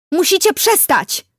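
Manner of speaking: high, loud, drawn-out cry; girl yelling at them to stop; shouted demand. A narrator's reading. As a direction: shout it urgently from a distance. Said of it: "krzyknęła".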